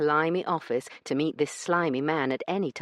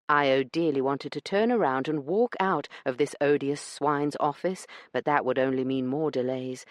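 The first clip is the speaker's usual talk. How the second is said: ordinary talk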